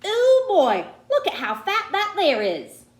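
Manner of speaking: put-on a country boy calling out across the playground; excited holler with a laugh. A narrator's reading. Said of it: "hollered out".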